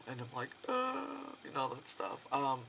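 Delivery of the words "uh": high, soft drawn-out whimper; mock a speechless little high wail; overwhelmed squeal. Fan retelling meeting George Romero, high overwhelmed squeal. Re-enacts being overwhelmed by praise.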